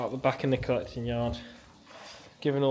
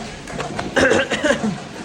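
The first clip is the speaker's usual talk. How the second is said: rough throaty cough; real cough; 9 semitones higher, 4 dB louder than usual. Real, not acted. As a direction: clear a sore throat. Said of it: "Losing my voice".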